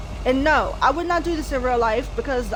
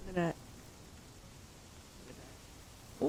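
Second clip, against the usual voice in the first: whisper; 5 semitones lower, 20 dB quieter than usual. Real, not acted. Creeping up, whispering.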